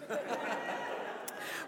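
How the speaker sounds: a light, breathy laugh. Real, not acted. Preacher laughs at the mum's joke, light laugh. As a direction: laugh softly at the punchline.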